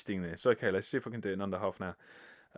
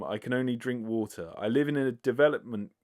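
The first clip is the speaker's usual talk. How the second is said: ordinary talk, nothing marked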